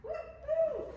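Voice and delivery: high excited whoop; cheering. Real, not acted. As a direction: whoop with joy. Cheering at end of set.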